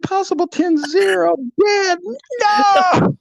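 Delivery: very high, loud shriek of protest; outraged disbelief. Retold or imitated. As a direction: screech it back in horrified disbelief. Outraged reaction to being reported dead.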